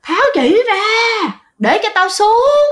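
high, loud demonic yell at the son; angry spirit screaming through the old mother's body; possessed old woman roaring. Retold or imitated. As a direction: snarl it out like a furious ghost. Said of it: "bả gào nha".

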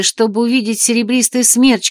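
ordinary delivery, nothing marked

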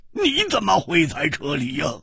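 high, giddy, delighted question; laughing exclamation. A narrator's reading. Friend to his long-lost pal, laughing and overjoyed. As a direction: ask as if bursting with glee. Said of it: "也跳起来大笑道".

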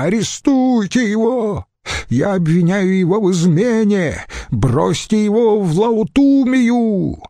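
high-pitched frenzied commands; furious cries. A narrator's reading. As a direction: work up into high, enraged accusation. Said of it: "выкрикнул тот". (яростные крики)

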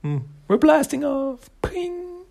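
very high, cartoon-bright cheer; gleeful flying cry. Jennika thrown half a mile away, squealing with glee. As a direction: squeal it as you sail away. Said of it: "And Jennika's".

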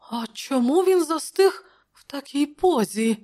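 high-pitched uneasy question; nervous, not truly whispered. A narrator's reading. Counsellor backing away from the corpse, nervous. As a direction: ask it in a high, alarmed voice. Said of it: "прошепотів Публій".

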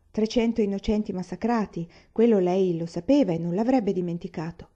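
ordinary delivery

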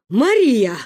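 loud, alarmed grandmother's call; wailing lament. A narrator's reading. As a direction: lament over the child. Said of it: "запричитала Ба". (причитая, громко)

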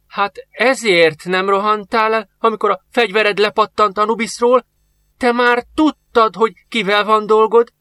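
put-on old master confronting the soldier; realising accusation. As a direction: accuse him as it dawns.

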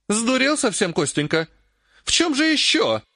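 high-pitched incredulous scolding; acted exasperation, pitch leaping up; scornful disbelief. A narrator's reading. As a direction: scoff as if he's lost his mind. Acted quarrel, she scoffs at him.

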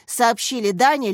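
ordinary delivery, nothing marked

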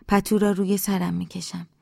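ordinary delivery

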